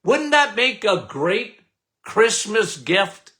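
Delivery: ordinary delivery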